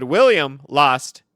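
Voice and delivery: loud, emphatic mock boast; imitated loud gloating; boasting rival. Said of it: "He said".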